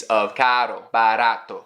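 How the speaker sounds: "caro" and "barato" in Portuguese, with the R tapped once; Portuguese words. Shown as a demonstration. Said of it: "the R in Portuguese".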